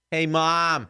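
mock yell for a parent off camera; calling for Mom. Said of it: "be like".

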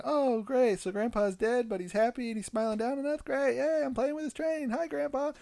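high, bright, mock-happy child; high sarcastic yay hi Grandpa; sarcastic cheer. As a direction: parody the sugary happy reaction. Parodying a happy kid.

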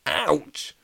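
high yelp of pain. A narrator's reading. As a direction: yelp as if just struck. Hit on the head.

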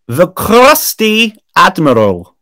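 slow, grand chapter announcement; dramatic title read. Acted. Reads chapter title aloud.

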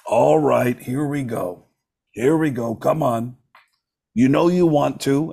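lightly voiced rock star impression; a hint of a celebrity coaxing someone on. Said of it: "I do an impression of [name]".

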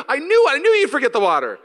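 smug, wounded accusation; upset complaint. Retold or imitated. Husband blaming wife over the water, high told-you-so whine. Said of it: "started getting upset".

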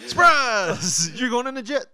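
loud, high cheery surprise shout; spring it on him like a party; mock surprise. Coworkers surprising the man with a jet ride, cheery shout.

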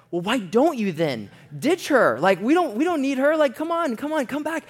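high, loud, rising urging; exasperated pleading. Retold or imitated. Friends urging buddy to ditch his girlfriend, exasperated pleading. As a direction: plead with him, pitch climbing as you go.

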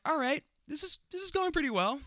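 very high, giddy squeal of words; giddy self-congratulation. Acted. Boy congratulates himself after a kiss, high and giddy. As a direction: squeak it out, coming down by the end.

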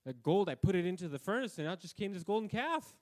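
mock Aaron, high and loud; Aaron's excuse. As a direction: play the caught brother making it up. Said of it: "Aaron had to tell Moses".

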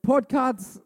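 loud shout-out; loud call out. Real, not acted. Pastor loudly calling out to his podcast audience.

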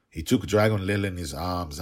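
ordinary delivery

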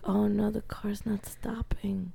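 hushed, slow, breathless thought; whispering as the car won't stop; whispered panic. Retold or imitated. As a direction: whisper it like a dread realization. Retold inner panic, whispered.

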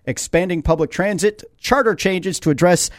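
steady radio ad read. Acted. Host reading the mayor's twelve-point plan, ad-read voice. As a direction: list the points like a sponsor spot.

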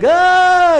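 a high, loud imitated baby wail; playful mock infant cry. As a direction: squeal like a startled baby. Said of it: "your little baby is just like".

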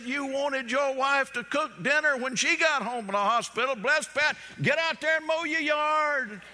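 high, loud playful scold; preacher turning the husband's words back on him; mock scolding. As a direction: rub it in with a grin. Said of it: "preacher turns tables on husband".

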